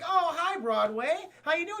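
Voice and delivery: high friendly hello; bright greeting. Retold or imitated. Elisa welcoming Broadway home, high and friendly. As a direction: greet him all sweetly.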